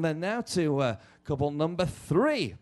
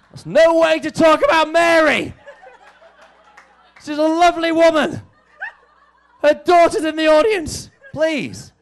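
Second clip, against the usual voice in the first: loud, high mock scandalised protest; mock outrage; 10 semitones higher, 11 dB louder than usual. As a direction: play up being shocked. Host mock outrage at joke.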